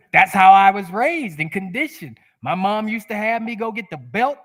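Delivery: usual speaking voice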